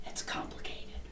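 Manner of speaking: faint, breathy whispered reply; ghostly whisper. Retold or imitated. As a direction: barely voice it, like a spirit.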